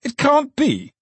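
very high, shocked cry; high exclamation of horror; aghast disbelief. A narrator's reading. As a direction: refuse to believe it, pitch leaping right up. Alderman disbelieving terrible news.

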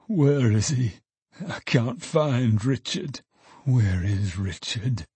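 slow, drawn-out searching lament; a touch of forlorn pleading; plaintive moaning. A narrator's reading. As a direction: moan it slowly while searching. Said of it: "moaned Trotty".

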